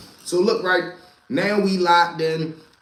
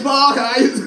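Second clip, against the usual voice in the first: yelling; 7 semitones higher, 5 dB louder than usual. Retold or imitated. Pookie yelling his block.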